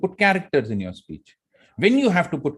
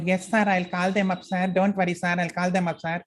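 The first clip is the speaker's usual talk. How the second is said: higher, eager secretary voice with a foreign lilt; Lily's accent; 6 semitones higher than usual. Retold or imitated. Secretary Lily assuring her boss, in her own accent. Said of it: "you saw how I changed my voice?".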